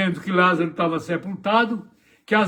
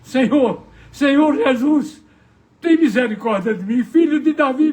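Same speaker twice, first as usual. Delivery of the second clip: loud, high crying out; 6 semitones higher, 5 dB louder than usual. Acted. Blind man begging Jesus for mercy, loud cry. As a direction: call out desperately from the roadside. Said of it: "lá estava um clamando".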